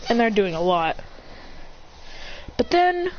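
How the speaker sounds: ordinary talk, nothing marked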